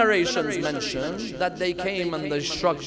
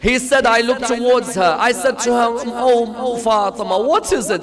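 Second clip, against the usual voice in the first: higher, louder lamenting narration; mournful preaching; 7 semitones higher, 9 dB louder than usual. Real, not acted. Preacher retelling his dream dialogue with Fatima, mournful preaching. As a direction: press on with the sorrowful tale.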